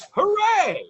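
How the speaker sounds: high, loud sarcastic hooray; mock cheer. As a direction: cheer with fake enthusiasm. Mock cheer at gender reveal.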